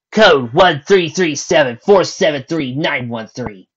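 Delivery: slow impatient recitation. Acted.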